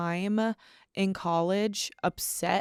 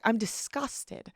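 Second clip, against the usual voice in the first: higher, drawn-out mock disgust; disdainful; 4 semitones higher, 3 dB quieter, slower than usual. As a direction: act out disdain, stretching the words. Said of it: "I have this look of disdain".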